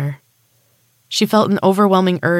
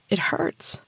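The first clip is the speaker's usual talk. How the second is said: pained whisper; 2 semitones higher, 7 dB quieter than usual. Acted. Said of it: "she groaned".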